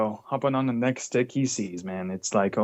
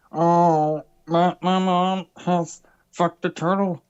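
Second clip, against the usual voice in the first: slow, higher put-on voice; D Welsh impression; 5 semitones higher, much slower than usual. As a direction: drag each word like a goofy impression. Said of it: "this does sound like D Welsh".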